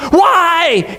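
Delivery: high, baffled question; confused. Acted. Pilate questioning the crowd, really confused. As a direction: ask it in bewilderment. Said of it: "Pilate's really confused".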